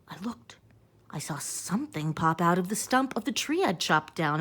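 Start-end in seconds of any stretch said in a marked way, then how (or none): none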